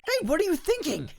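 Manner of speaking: high-pitched exasperated thought; exasperated inner voice. Acted. Game lawyer objecting in his head, exasperated.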